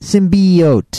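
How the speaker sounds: "Symbiote" slowly as sim-BEE-oat; emphasized E. Shown as a demonstration. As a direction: stretch the EE in "Symbiote". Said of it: "the key thing here is the E".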